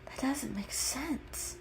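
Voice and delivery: soft, slow puzzled murmur; very confused. A narrator's reading. Peaches reacts to the sparrow story, soft and confused. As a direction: puzzle it out quietly to yourself. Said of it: "very confused".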